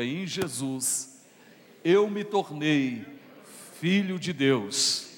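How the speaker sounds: slow, loud, every word leaned on; loud declaration. Shown as a demonstration. Congregation told to repeat it, slow loud proclamation. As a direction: proclaim it out loud to the church, slowly. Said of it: "levanta a tua mão, diga".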